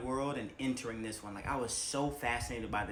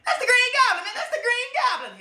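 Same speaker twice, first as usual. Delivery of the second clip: very high, loud shout; hyped fanboy shout; excited recognition; 10 dB louder than usual. Retold or imitated. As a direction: freak out spotting him. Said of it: "I was like".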